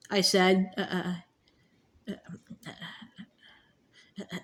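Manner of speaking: ordinary delivery, nothing marked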